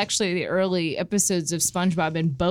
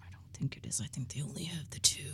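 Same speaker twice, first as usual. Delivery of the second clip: whisper; 7 semitones lower, 17 dB quieter, faster than usual. Real, not acted. Whispered aside.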